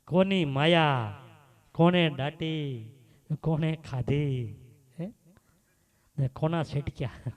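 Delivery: drawn out slowly, each question weighed; slow, pointed questions to the crowd; slow, preaching rhetorical questions. Real, not acted.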